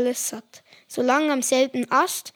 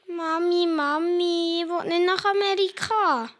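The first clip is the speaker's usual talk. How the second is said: put-on higher whiny kid voice; child pleading; 5 semitones higher than usual. Boy begging his mother, higher whiny voice. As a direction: beg like a small child.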